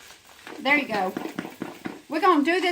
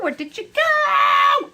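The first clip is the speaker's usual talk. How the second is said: higher, louder, slow playful call; mock calling out; 12 semitones higher, 7 dB louder, much slower than usual. Real, not acted. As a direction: call out after the runaway potato. Hunting for a dropped potato.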